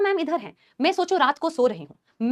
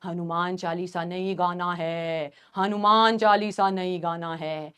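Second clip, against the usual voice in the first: low, slow drawn-out eerie voice; possessed senior; 9 semitones lower, 5 dB quieter, slower than usual. Retold or imitated. Possessed senior forbidding the prayer up close, low and slow. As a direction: intone it slowly like someone possessed. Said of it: "कह रही एकदम पास आके".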